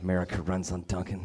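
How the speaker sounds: scornful low "America runs on Dunkin'"; muttered slogan. Shown as a demonstration. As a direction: sneer "America runs on Dunkin'" under the breath. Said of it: "slogan repeated mockingly".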